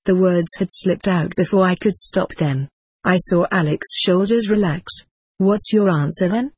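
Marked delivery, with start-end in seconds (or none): none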